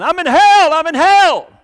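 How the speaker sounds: high, full-force panicked yell; acted yelling. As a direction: yell it as loud as you can, like the revived patient. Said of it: "he just started yelling".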